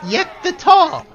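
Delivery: "Yek the Tall." slow and loud, weight on "Tall."; announcing title. Shown as a demonstration. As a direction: proclaim "Yek the Tall." slowly, hitting "Tall.". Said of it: "he is referred to as Yek the Tall".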